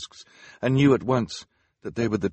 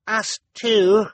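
excited cry; 8 dB louder than usual. A narrator's reading. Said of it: "Sonny cried".

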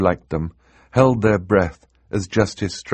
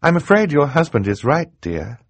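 high-pitched, regretful woman's voice; sad; 9 semitones higher, 3 dB louder than usual. Acted. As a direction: break bad news sadly, dropping on "dear". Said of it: "Justice Strauss said sadly".

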